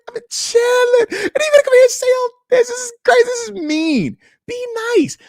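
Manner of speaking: high, whining, half-crying pleading; whiny mock plea. Whining at X Concepts over the Bills line, high and pleading. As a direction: play wounded and beg in a high voice.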